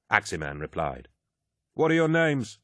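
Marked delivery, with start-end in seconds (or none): 1.7-2.6 hidden guard voice. Acted. Hooded figure challenging.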